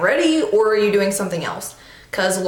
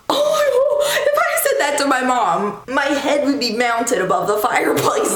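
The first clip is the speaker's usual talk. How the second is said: shocked exclamation; 4 semitones higher than usual. Retold or imitated. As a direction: act appalled at the idea. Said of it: "I'm like".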